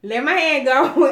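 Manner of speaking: a sharp, loud warning; snapped order to let go; urgent demand. Retold or imitated. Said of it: "I told him, I said".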